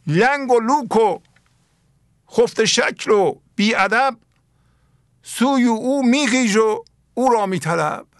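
slow, high, sung-out verse reading; solemn classical Persian verse, drawn out; poem recitation. Acted.

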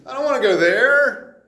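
put-on bratty teenager shouting no; whiny kid refusing. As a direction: play a teen flatly refusing the plan. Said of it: "everybody's gonna say".

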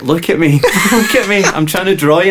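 high, loud, laughing mimicry of an insistent stranger; hammed-up eager artist begging for attention; imitating pushy stranger. Traveller mimics the pushy bar stranger who insists on drawing him, high and laughing.

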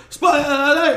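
high, loud mock alarm; movie catchphrase yell. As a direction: shout it over everyone as a film quote. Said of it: "It's from Twenty-one Jump Street".

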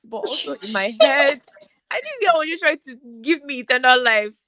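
high voice breaking into tears; real tearful confession. Real, not acted. Confessing how she treated a friend, really tearful. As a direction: talk through tears, pitch climbing.

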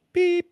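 high-pitched sustained beep; imitated censor beep. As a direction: bleep yourself out, high. Self-censors a swear word.

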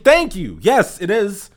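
high, relieved thank you; finally someone gets it; vindicated agreement. Retold or imitated. He agrees with the second clerk, high vindicated relief.

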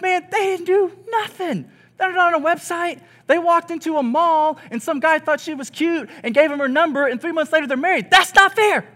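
exasperated rant. Retold or imitated. Single friend ranting about dating, exasperated and rising. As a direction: vent the unfairness until it breaks high. Single person's outraged grumble.

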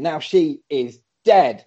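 usual speaking voice

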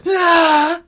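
high, loud mock war cry; imitated yell. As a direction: yell like you're stabbing. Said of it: "So he'd be like".

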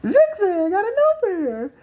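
high, excited commentator call; imitating Jerry Lawler. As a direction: shout the finisher like a ringside announcer. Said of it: "Jerry King Lawler said".

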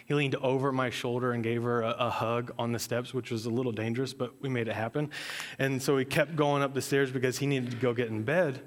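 ordinary delivery

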